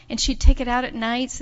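plain reading voice

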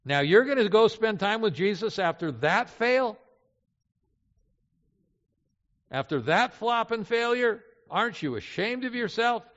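high-pitched, indignant shaming; accusing snake. Acted. The snake shaming a believer who failed, accusingly. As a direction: scold like an accuser heaping on shame. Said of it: "the snake comes along and he says".

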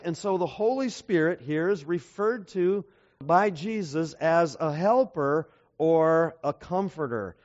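usual speaking voice

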